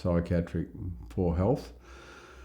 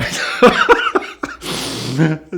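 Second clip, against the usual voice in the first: big real laugh; 9 semitones higher, 9 dB louder than usual. Real, not acted. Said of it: "we had a big laugh".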